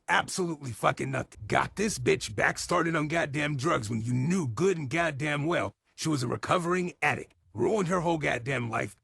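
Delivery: ordinary talk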